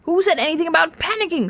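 high, fast, panicky snap; snapped retort. A narrator's reading. Arthur told to stop panicking, snapping back. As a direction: fire back shrilly in denial. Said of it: "snapped Arthur".

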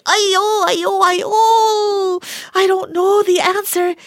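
high, loud mock wail of exam panic. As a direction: wail like a student who is stuck on the exam. Panicked student acting out exam.

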